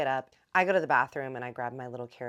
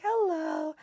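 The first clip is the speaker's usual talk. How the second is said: much higher, louder playful hello; imitating Kristen's cute, much higher hello; mock sing-song hello; 7 dB louder than usual. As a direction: chirp it like a sweet greeting. Kristen greets sleepily in the morning.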